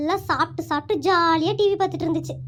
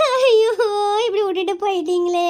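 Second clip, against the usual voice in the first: fake funeral wailing. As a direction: wail like a hired mourner, sobbing. Neighbour breaks into funeral wailing. (ஒப்பாரி வைத்து பொய்யாக அழுகை)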